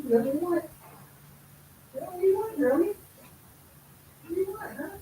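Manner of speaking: high, sing-song questions to the bird; pet talk. Real, not acted. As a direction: coax the little bird gently. Asking the bird what it wants.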